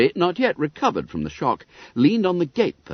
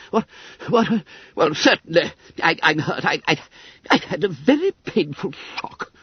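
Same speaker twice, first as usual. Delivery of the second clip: high, breathless fussy little man; gurgling winded secretary; 6 semitones higher than usual. Acted. Little secretary knocked flat, spluttering high and breathless. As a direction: splutter like a winded old clerk.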